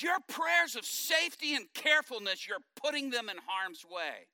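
high, loud correcting rebuke; forceful rebuke. Retold or imitated. Wife rebuking her husband's safe prayers, high and loud. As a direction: set him straight with force. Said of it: "And she goes".

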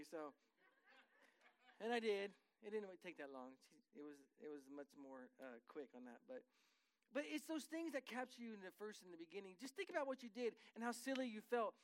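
ordinary talk

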